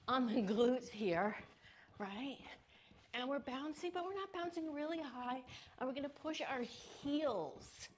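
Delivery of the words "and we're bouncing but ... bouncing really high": usual speaking voice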